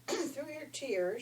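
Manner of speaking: usual speaking voice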